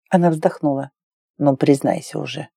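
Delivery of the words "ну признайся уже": low, soft man's urging; lightly voiced coaxing lover. A narrator's reading. Lover urges Claire to confess, low soft coaxing. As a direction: coax quietly in a lowered voice. Hero presses her to confess.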